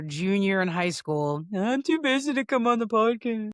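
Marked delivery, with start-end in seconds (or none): 1.6-3.5 higher-pitched mock teen whine; busy teenager. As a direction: put on a too-busy teenager. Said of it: "become a elder teenager".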